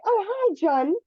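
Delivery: delighted rising hello; excited greeting. Shown as a demonstration. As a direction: pitch leaps way up on "Oh, hi". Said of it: "Alice is excited to hear from John".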